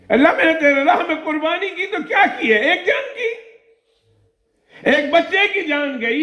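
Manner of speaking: high, pleading cry to God; woman weeping her prayer before execution; tearful prayer. Acted. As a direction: weep out her plea, voice breaking. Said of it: "رونا شروع کر دیا".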